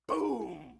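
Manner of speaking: booming explosion word, far and loud. A narrator's reading. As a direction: shout the boom like a blast. Narrating epic explosion.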